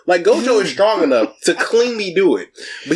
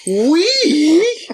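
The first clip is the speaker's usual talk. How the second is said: high, rising incredulous squeal; mock indignant disbelief; 11 semitones higher than usual. Imitating a student hearing himself called weak, comically incredulous. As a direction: echo the insult higher each time, half laughing. Acting out Yuji's offended disbelief.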